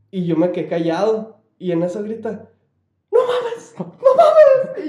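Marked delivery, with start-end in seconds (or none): 3.1-4.9 very high, loud panicked cry with a laugh; scared shriek. Retold or imitated. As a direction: shriek it in fright. Coworker screams at slammed door.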